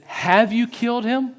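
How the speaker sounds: high, slow, rising question; doubting question. Retold or imitated. Elijah questions God about the dead boy, doubting. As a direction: ask God in disbelief, pitched high. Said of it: "doubt and question in his tone".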